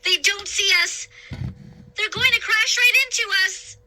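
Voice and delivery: high, loud terrified cry; panic. A narrator's reading. Girl seeing the ship about to hit, terrified cry. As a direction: scream the danger.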